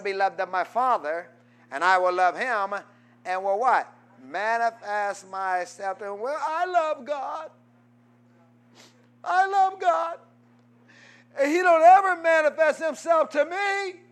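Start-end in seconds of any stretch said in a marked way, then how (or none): 6.2-14.0 mock churchgoer protest.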